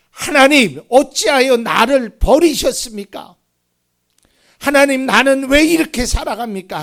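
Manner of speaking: loud, high-pitched cry to God; anguished outcry. Acted. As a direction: cry out the lament at full force. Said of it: "막 절규의 소리를 하는 거예요".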